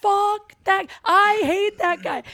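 high, loud mocking impression of the guy. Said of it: "You were like".

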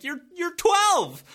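high, loud, slowed disbelief; incredulous. Retold or imitated. Coach marvelling at a twelve-year-old's power, high incredulous. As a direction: stretch it out, stunned at his age. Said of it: "and I'm like".

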